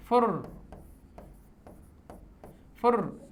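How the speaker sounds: फुर with the r trilled and the tongue fluttering; फुर, trilled r sound; rolled r demo. Shown as a demonstration. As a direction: let the r in फुर flutter on the palate. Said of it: "जीभ फड़फड़ाएगी".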